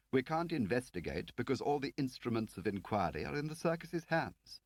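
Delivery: a prim Whitehall man; fussy official Lakin. Acted. Lakin frets about the mole.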